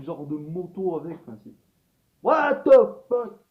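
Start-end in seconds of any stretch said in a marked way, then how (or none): 2.2-3.4 loud, high, slowed what the fuck; shocked outburst at the flying motorbike; loud disbelief. Real, not acted. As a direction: blurt out your disbelief. Baffled by Hagrid's motorbike.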